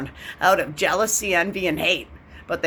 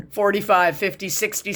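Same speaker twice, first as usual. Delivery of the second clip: ordinary talk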